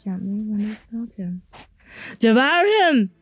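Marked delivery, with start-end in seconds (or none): 2.2-3.1 loud, urgent shout; shouted command. Acted. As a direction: bellow orders at attack dogs. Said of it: "They shouted to".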